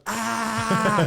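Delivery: sharp high yell, like lunging with a knife; mock attack yell. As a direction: let out a short attacker's shout. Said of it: "just grabs him and just goes".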